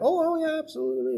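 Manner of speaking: hammed-up cheery Jehovah's Witness; mock eager believer. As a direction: toss it off brightly like a too-easy yes. Mocking a Jehovah's Witness reply.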